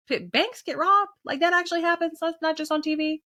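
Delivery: naive disbelief. Retold or imitated. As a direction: play the shocked newbie who just learned banks get robbed. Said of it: "And I was like".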